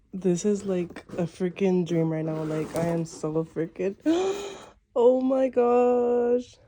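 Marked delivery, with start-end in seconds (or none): 4.0-4.5 sharp high gasp; excited gasp. Real, not acted. Overwhelmed reaction to unboxing the package. 5.0-6.6 slow, higher, drawn-out amazement; thrilled disbelief. Real, not acted. Thrilled reaction on opening the package.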